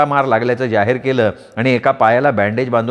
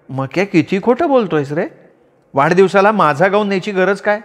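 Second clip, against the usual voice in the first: higher, exasperated wife voice; scolding wife; 6 semitones higher than usual. Acted. Wife Manda scolding her clumsy husband, higher voice.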